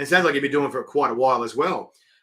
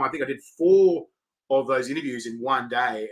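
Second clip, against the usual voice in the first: ordinary delivery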